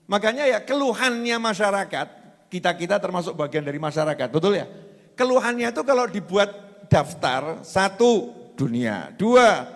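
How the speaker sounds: loud, high-pitched preacher's delivery; impassioned preaching. Acted. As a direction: preach it out at full force. Preaches at full force.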